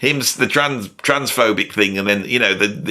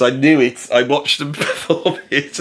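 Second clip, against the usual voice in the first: ordinary delivery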